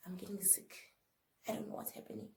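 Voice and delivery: hushed, almost whispered worry; quiet worried murmur. Retold or imitated. To her brother at the sangoma's, a hushed whisper. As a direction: tell your brother under your breath you feel sick.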